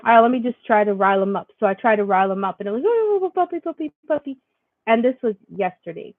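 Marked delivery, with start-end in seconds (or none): 2.9-4.4 sing-song high puppy talk; high baby talk to puppy. Retold or imitated. Mom riling up her puppy, high sing-song baby talk. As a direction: coo at the dog in a high voice.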